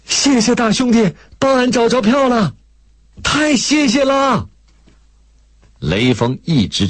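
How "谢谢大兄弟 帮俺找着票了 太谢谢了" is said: joyful calling out. A narrator's reading. Country woman calling back to Lei Feng, joyful shout. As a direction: shout thanks while walking away, overjoyed. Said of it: "边走边喊".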